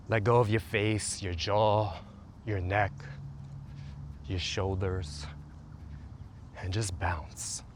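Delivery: slow, soft meditation voice; guided meditation. Acted. Coach leading listeners through a calming routine, soothing meditation voice. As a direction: guide them gently into the body.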